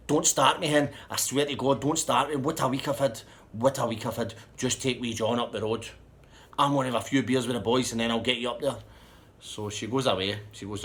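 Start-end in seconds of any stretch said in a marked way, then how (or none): 0.1-8.8 loud, higher retold rant, easing slightly; acted exasperated anger. Man orders his partner up the road without him, angry. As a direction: warn them off, loud and fed up.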